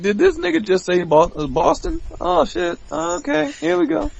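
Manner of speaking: high, amused disbelief; mock disbelief. Wrestler hearing the wrong city named, high amused disbelief. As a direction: react like you can't believe it. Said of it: "I'm like".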